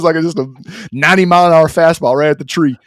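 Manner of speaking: ordinary delivery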